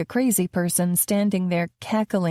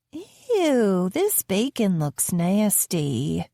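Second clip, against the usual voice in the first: put-on a fussy girl turning up her nose at breakfast; disgusted little girl; much slower than usual. Girl complaining to her nanny about the bacon, slow and disgusted.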